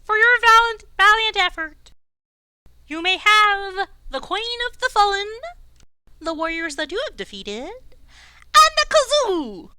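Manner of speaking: high, proud croaking king voice; warbly frog king. Acted. Frog king chats with the passing warriors, high and warbly. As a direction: play the pompous frog king.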